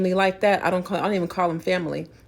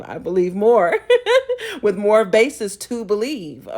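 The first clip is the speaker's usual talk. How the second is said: laughing; 3 semitones higher, 8 dB louder than usual. Real, not acted. Laughs mid-sentence.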